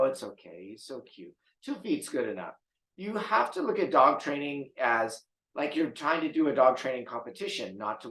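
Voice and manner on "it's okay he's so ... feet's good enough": much softer, lower, doting mumble; mock indulgent coo. Trainer mimicking a soft owner letting the dog off, hushed. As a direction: mimic the pushover owner under your breath.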